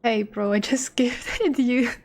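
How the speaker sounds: ordinary talk, nothing marked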